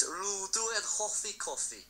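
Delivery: careful learner's Welsh; spoken Welsh. Shown as a demonstration. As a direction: recite a memorised Welsh sentence slowly. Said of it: "one sentence in Welsh".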